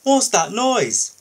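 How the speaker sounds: high, loud, slow startled question; a loud surprised call; alarmed question. Acted. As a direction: ask sharply, just woken by a noise. Woken by whistle, alarmed.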